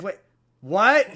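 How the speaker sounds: exclaiming in mock disbelief. Viewer reacting to the angel's arbitrary rule, in loud mock disbelief.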